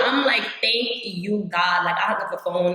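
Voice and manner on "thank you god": ordinary talk, nothing marked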